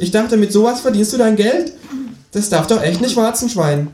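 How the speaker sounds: very high kangaroo character voice; cheeky kangaroo, teasing. A narrator's reading. Kangaroo mocking narrator's line of work, high teasing voice. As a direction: needle them as a talking kangaroo.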